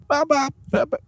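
very high, softened chicken squawks; poor chicken impression. Shown as a demonstration. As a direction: try clucking way up high, badly. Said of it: "I can't even chicken impre".

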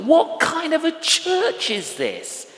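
high, loud mock outrage; indignant inner voice. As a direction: play your own self-righteous complaint in a high put-on voice. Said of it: "And I thought".

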